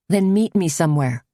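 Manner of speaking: usual speaking voice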